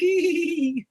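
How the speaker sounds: high, long "eee" squeal; crowd squeal. Acted. Imitating a convention crowd seeing onions, squeal. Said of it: "so everybody can be like".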